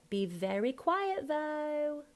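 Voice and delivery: high "though" as vow; slight ow ending. Shown as a demonstration. Coach refining Horace's line, slight ow ending. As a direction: THOUGH lifted high, o widened a bit. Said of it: "more of an ow".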